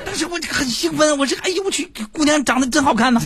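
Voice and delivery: high, giddy rush of words; excited. Retold or imitated. Host recalling the pretty girl, high giddy excitement. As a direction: tumble out the thrill, climbing high.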